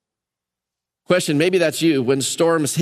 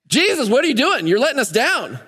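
mock angry; 7 semitones higher than usual. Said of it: "it looks like they're angry".

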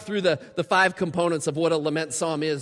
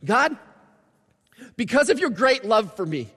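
higher, louder confident hope; earnest prayer of hope; hopeful declaration; 4 semitones higher, 5 dB louder than usual. Pretend. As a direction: declare trust at the close. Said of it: "look for this".

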